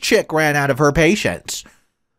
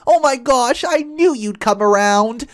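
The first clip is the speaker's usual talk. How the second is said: delusional stepmother-in-law; 12 semitones higher than usual. Acted. Stepmother-in-law calling out over her shoulder, high and giddy. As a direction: gush in a high voice, sure she has won. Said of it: "This woman was screaming over my shoulder".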